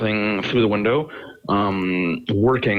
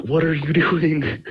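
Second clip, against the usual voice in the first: higher, slow, drawn-out teacher voice; mock teacher, pitched up and stretched out; shocked teacher; 7 semitones higher, much slower than usual. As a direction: imitate a teacher's slow disbelief. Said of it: "The teacher's like".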